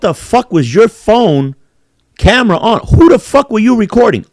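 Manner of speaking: high, loud accusing shout at the man filming; mock angry yell. Confronting the man recording kids, shouting high and loud. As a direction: bark it at him like catching him red-handed.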